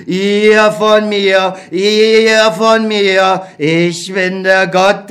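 slow, sing-song lament of an abandoned god; drawn-out, mournful and helpless; forsaken old God. Acted. God complains to Beckmann that nobody believes, slowly lamenting.

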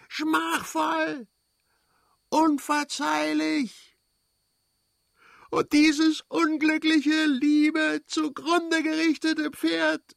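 very high, slow, self-accusing wail; despairing scream. A narrator's reading. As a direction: cry out every word in agony, drawn-out and high. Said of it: "schrie er". (verzweifeltes, gedehntes Schreien in hoher Lage)

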